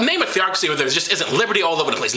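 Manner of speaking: normal talking voice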